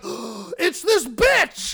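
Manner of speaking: gasp, then a slow, high, loud mock yell; startled game enemy. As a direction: drag out an over-the-top shocked shout. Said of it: "they see you and they're like".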